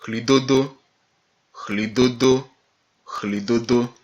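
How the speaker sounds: "Chlidodo" opening on a rough airy CH; aspirated H. Shown as a demonstration. Teacher demonstrates the hard-to-explain H, "Chlidodo" with airy CH. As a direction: start "Chlidodo" as if drawing in air. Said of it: "on aspirait de l'air".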